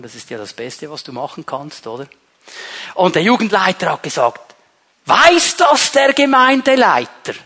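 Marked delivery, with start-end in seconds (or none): 5.0-7.3 questioning youth leader. Retold or imitated.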